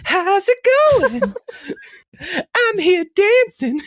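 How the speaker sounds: high breathy falsetto celebrity voice; squeaky high falsetto hello. Acted. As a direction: go way up high for a pop star greeting. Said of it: "First impression, number one".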